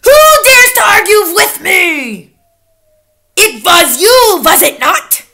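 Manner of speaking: loud, high witch accusation; snapped. A narrator's reading. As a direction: accuse them in a furious shout. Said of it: "she snapped".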